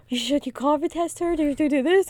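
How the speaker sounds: high, fussing mimic voice; fussy relatives. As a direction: imitate everyone hovering at once. Family fussing, quoted.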